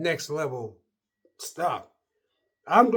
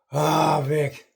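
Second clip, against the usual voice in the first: louder, fed-up "Ah, Vic"; talking to himself, fed up; exasperated; 6 dB louder than usual. Retold or imitated. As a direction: groan out your own name in frustration. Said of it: "I go like this".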